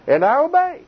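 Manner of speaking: obey lifted high and strong; firm declaration. Retold or imitated. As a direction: declare and I obey with rising conviction. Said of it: "he basically said".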